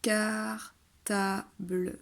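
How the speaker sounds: car-ta-ble drawn out slowly syllable by syllable in cartable; teacher slowly spelling out cartable. Shown as a demonstration. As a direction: stretch cartable into slow syllables. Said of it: "faites-lui chuchoter la syllabe du milieu".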